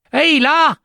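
excited high-pitched holler; shouted hail. Acted. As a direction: hail someone far ahead. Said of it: "gridò Giambabbeo".

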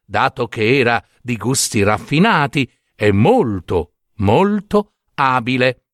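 usual speaking voice

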